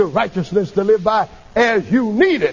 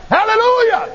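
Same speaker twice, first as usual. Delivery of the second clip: very high, ringing cry of praise; shouted hallelujah. Retold or imitated. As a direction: let it burst out at the top of your range. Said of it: "I couldn't help but shout".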